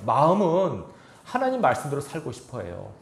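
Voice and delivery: ordinary talk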